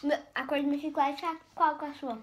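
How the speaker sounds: talking while chewing; chewing gum. Real, not acted. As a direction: mouth full of gum. Seen 1: Girl in pink chews, shows gum on tongue at 3.2-3.5s.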